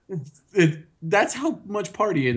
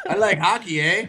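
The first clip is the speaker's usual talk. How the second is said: mock Canadian accent; 4 semitones higher than usual. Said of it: "Canadian, eh?".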